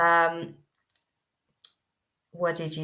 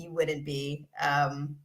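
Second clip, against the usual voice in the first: usual speaking voice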